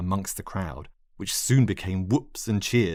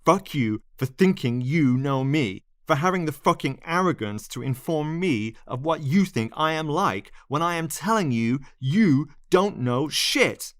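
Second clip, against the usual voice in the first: higher, heated rant; angry tirade; 7 semitones higher than usual. Acted. Lionel ranting at the narrator, heated and higher. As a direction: tear into her.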